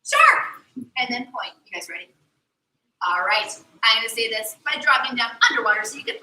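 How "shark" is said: high, loud call of shark; shouted shark. Shown as a demonstration. Mermaid to kids, shouting the shark sign word. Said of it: "I'm gonna try to say shark to you".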